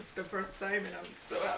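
low, soft, tearful and overwhelmed; crying from happiness. Real, not acted. Mom thanking family for first bag, tearfully happy. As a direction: drop to a small, choked-up voice of happiness. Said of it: "you're crying of happiness".

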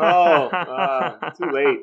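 laughing through the words at the pun; amused laugh breaking into the reply. Real, not acted. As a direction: chuckle out a caught-out groan.

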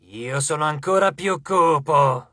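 slow growled one-upmanship; drawn-out menacing growl. A narrator's reading. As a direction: growl it slowly like an ancient dark god. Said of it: "ringhiò Geras".

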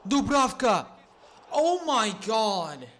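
high, loud cry of disbelief; excited shout. Real, not acted. Gamer watching his keeper save twice, shouting. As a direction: yell at the saves in shock. Keeper makes desperate saves.

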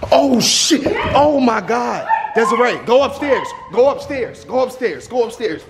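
sudden alarm, hurry them; panicked urgency. Real, not acted. Sees intruder, sends kids upstairs.

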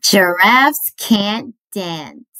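plain reading voice

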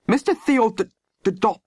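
high, stumbling over the name; indignant spluttering. A narrator's reading. Landlady failing to name her Greek lodger, indignant spluttering. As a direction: splutter and trip on the surname. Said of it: "she spluttered".

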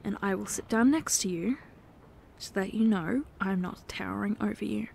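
soft whisper beside them. Acted. Sitting next to the partner in bed, soft whisper. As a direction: whisper it gently, close by.

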